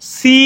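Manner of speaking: teacher voicing সি; letter demo. Shown as a demonstration. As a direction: model সি for pupils to repeat. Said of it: "সি উচ্চারণ করো".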